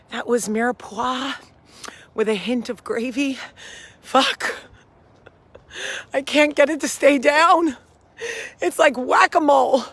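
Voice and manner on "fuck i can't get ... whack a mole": acid reflux distress. Real, not acted.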